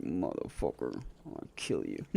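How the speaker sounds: low whispered mock threat; voicing angry pet. Owner voices his annoyed pet as a low whispered grump. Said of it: "She's like".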